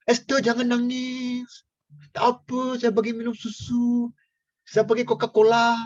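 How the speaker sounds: put-on teasing a grown woman as if she were a baby; mock baby coo. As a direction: coax Esther like an infant. Cooing at Esther like a baby.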